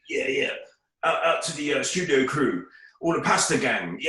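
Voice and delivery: hyped garage MC shout-out; pirate radio DJ voice. Retold or imitated. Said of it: "in the most pirate radio voice".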